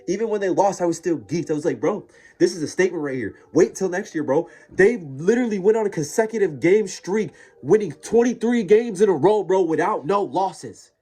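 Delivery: high, loud excited raving; hyped-up sports fan gushing; geeked fan. Real, not acted. Geeked fan raving about team.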